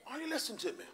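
normal talking voice

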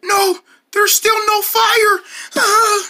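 high, slow whine of disappointment; comic despairing moan; dismayed wail. Acted. Wailing that no fire came.

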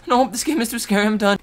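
high, loud pretend freak-out; mock panic. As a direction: cry out as if quitting in fright, jokingly. Mock quitting, then "just kidding.

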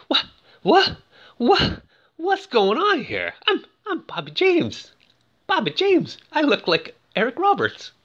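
high, whiny flustered voice of a rejected show-off; hammed-up bewildered pride; mock stunned disbelief. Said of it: "he's blown away".

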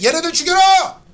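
high loud mob cry; shouting. Retold or imitated. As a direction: bark it like a mob. Mimics online mob call. (높게 외치는 고함)

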